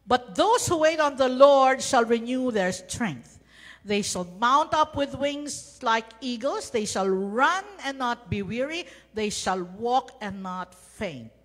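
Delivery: loud, ringing proclamation of the verse; preacher booming the scripture at full voice; proclaimed. Acted. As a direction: declare it boldly to the congregation.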